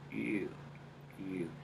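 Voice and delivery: soft ew placed in the upper nose; tiny disgusted ew from the upper nasal; small nasal ew. Shown as a demonstration. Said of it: "that makes it really small".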